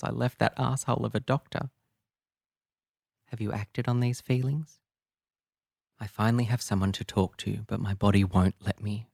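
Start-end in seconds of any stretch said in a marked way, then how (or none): none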